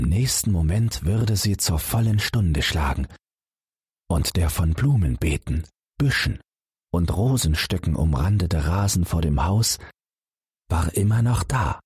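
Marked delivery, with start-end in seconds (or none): none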